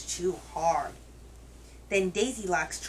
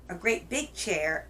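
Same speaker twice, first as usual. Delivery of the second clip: ordinary delivery, nothing marked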